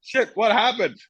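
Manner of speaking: flustered, what just happened; high bewildered panic. Retold or imitated. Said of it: "I was like".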